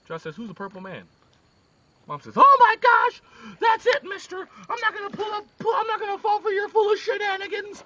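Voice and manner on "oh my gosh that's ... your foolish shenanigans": high, loud outraged mother; angry mom. Acted. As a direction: mom losing it at her son. Mom erupts at John.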